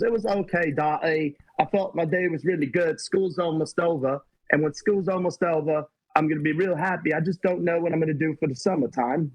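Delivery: hammed-up British-accented kid. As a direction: imitate your son in a mock British accent. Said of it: "British accent".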